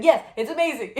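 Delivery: loud, high, laughing delight; retold excited cheer. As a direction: imitate thrilled good news, breaking into a laugh. MTI's happy news, retold.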